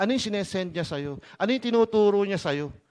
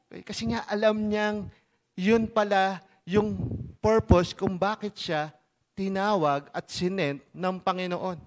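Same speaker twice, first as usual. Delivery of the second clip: normal talking voice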